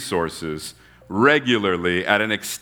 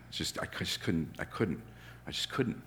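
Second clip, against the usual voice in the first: much softer, breathy near-whisper; whispered admission; 4 semitones lower, 16 dB quieter than usual. Real, not acted. Preacher admitting he could never beg, hushed. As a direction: trail into a hushed confession. Preacher imagining himself begging.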